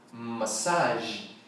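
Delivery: Massage said ma-SAHZH, no final j; English massage. Shown as a demonstration. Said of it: "em inglês é massage". (Massage sem o j no final, massáj)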